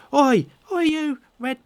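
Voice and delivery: high-pitched little lad squaring up; small boy's plucky threat; Carp, nervous boy. Acted. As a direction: challenge the strangers in a child's high voice. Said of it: "he's kind of nervous".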